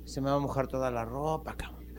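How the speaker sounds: soft, unvoiced whisper; whispered monkey. Monkey worried about rain soaking his clothes, whispered. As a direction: hush it like a worried aside. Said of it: "el chango dijo".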